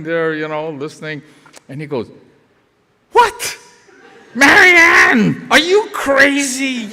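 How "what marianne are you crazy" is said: high, loud incredulous outburst; retold furious husband. Husband on the phone with his wife, furious. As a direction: explode in mock outrage, drawing out the last words. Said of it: "he was furious".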